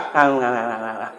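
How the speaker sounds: low, soft, slurred mumbling; stroke-stricken husband. Acted. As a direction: mumble like a man who can't speak. (suami kena stroke bergumam lemah)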